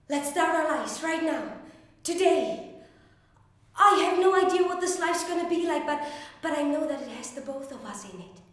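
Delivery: impassioned plea. Acted. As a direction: persuade a partner to choose love. Acted plea for life together.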